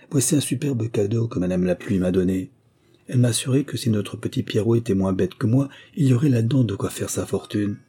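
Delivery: ordinary delivery, nothing marked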